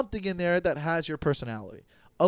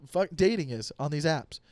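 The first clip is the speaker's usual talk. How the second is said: ordinary talk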